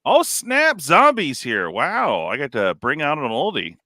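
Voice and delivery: usual speaking voice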